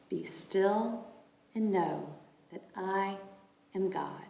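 unhurried, spaced-out phrases; slow meditative reading. Acted. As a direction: let each phrase settle slowly.

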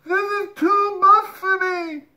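very high, loud, slow nasal squeal; stretched-nose elephant child wailing; through the nose. Acted. Elephant child with his legs slipping, nasal wail. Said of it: "he said through his nose".

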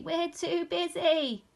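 high, slow, drawn-out bleat; bleating sheep. A narrator's reading. Sheep too busy for hugs, bleating. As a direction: stretch the words like a baa. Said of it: "barred the sheep".

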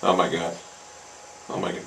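ordinary talk, nothing marked

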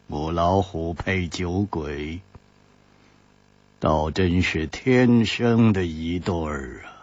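low, soft, slow mocking voice; cold sneer. A narrator's reading. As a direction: sneer it slowly from the dark. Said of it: "冷笑着说".

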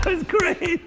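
high laughing; laughing at the lady's squeal. Real, not acted. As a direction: laugh through it was great. Laughs after imitation.

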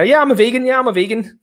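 high, eager fake agreement; mock agreeable voice. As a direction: brush them off brightly. Mocking fake vegan.

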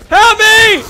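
very high, full-volume scream for help; screamed plea. Pretend. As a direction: scream for rescue at the top of your voice. Repeats plea, escalating to scream.

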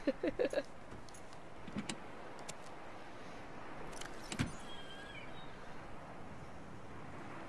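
breathless, soundless wheeze of a laugh; wheezing silent laugh. Real, not acted. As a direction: laugh till no sound comes out. Breaks up after the repeat.

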